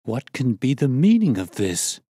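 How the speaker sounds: shocked husband demanding an explanation; bewildered outburst. A narrator's reading. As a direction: demand an answer, aghast. Munro shocked by the mask.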